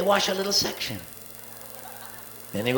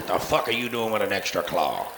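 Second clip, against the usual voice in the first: low mock-scolding voice; low gruff scold, half joking; mock stern owner; 10 semitones lower, 3 dB quieter than usual. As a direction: grumble it down at the kitten. Owner addressing kitten.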